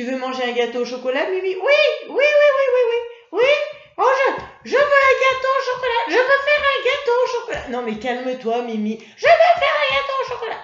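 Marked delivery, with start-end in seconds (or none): 1.6-7.7 put-on very high, loud, fast excited child puppet voice; Mimi the puppet. Puppet pestering the teacher for chocolate cake, high excited squeal. 9.0-10.6 put-on very high, loud, fast excited child puppet voice; Mimi the puppet. Puppet pestering the teacher for chocolate cake, high excited squeal.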